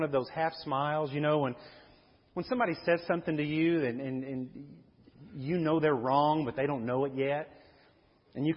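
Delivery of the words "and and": normal talking voice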